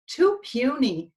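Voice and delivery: ordinary delivery, nothing marked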